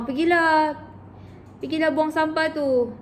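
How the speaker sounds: a hint of a scolding elder; mild mock order. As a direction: call the child to take out the rubbish. Elder orders child at home.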